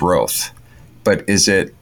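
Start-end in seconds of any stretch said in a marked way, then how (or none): 0.0-0.5 "Roth" with a long O, like "both"; American pronunciation. Shown as a demonstration. Host quoting how Americans say the town's name, "Roth" as rohth. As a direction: say "Roth" with an oh vowel. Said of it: "the American way to pronounce it".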